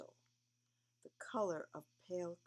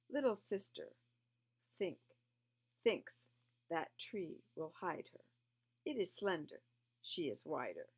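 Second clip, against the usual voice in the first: lively read-aloud poem voice; reading to children; 6 dB louder than usual. A narrator's reading.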